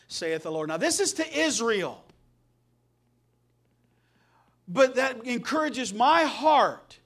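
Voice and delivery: loud, impassioned sermon delivery; fervent preaching. Real, not acted. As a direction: preach it out with conviction, pitch lifted. Preaches with raised fervour.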